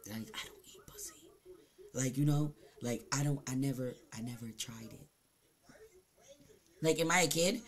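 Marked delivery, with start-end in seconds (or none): 0.1-1.1 whispered confession; whispered secret to viewers. Real, not acted. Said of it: "I'ma tell y'all a secret". 1.9-5.1 soft and low, still confiding; hushed confession continues. Real, not acted. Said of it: "I'ma tell y'all a secret".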